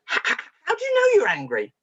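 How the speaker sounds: high, stammering puzzled question; baffled questioning. Retold or imitated. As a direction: act out being lost for what to ask. Retells her baffled repeated question.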